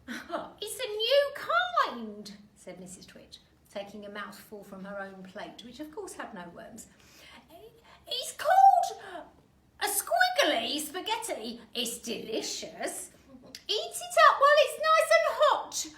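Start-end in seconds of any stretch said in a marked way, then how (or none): 0.1-2.4 put-on very high, very slow sly old woman; Mrs Twit voice. Missus Twit lying sweetly. 8.1-15.9 put-on very high, loud coaxing old hag; Mrs Twit voice. Missus Twit coaxing husband.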